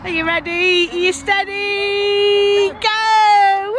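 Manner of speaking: high, loud, slow sing-song build-up; playful countdown. Real, not acted. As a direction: draw out the countdown, then burst. Countdown game with toddler.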